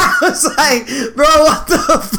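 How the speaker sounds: high laughing disbelief. Real, not acted. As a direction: squeal it through laughter. Laughing at friend's face.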